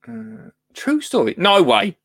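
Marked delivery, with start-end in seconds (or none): none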